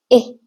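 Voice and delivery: इह as a short clipped i; short i sound. Shown as a demonstration. Teacher giving the short vowel for e, clipped. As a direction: say इह short, not ई. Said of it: "ई sound से नहीं पढ़ेंगे".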